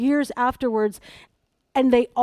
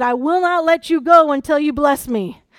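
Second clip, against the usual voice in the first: loud, insistent demand; tenacious; 3 semitones higher, 7 dB louder than usual. Acted. Preacher quoting Jacob wrestling with the angel, loud and tenacious. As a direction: hold on stubbornly and push it out loud. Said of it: "This is the same tenacity".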